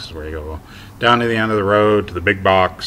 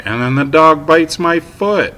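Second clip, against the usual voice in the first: suddenly louder, higher and slower, reliving the bite; agitated outburst; 10 semitones higher, 10 dB louder than usual. Real, not acted. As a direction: blurt it out as if it just happened again.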